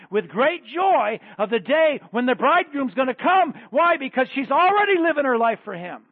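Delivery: loud, rising sermon climax; preaching at full force. Acted. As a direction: drive it home to the congregation, building.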